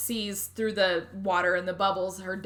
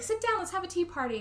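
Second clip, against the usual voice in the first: put-on girl's voice; 10 semitones higher than usual. Child character quoted in retelling.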